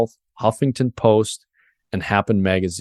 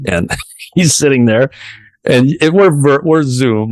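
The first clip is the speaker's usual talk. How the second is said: laughing through emotion; 4 semitones higher, 6 dB louder than usual. Real, not acted. Retelling tearful moment, laughing.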